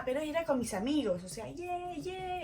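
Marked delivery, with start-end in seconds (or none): 1.5-2.4 high, softened cheering; small high-pitched cheers; retold celebration. Celebrating goals with her friends, mock cheer.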